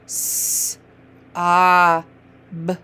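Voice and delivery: S long hiss, uh held, b short; sounded-out letters. Shown as a demonstration. Teacher modelling sounds for a reader, each one separate. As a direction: say S, uh, b as three isolated sounds. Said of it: "Start saying the sounds".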